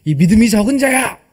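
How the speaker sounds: hammed-up exclaimed rebuke to a doubting follower; Jesus rebuking. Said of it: "막 책망을 하시는 거예요". (짐짓 꾸짖는 목소리)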